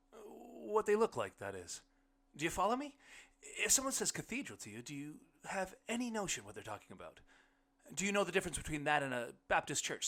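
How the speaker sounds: high-pitched, hurried questioning; groping explanation. A narrator's reading. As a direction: lift the voice, fishing for understanding. Narrator character fumbling to explain cathedrals.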